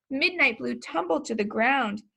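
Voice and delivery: plain reading voice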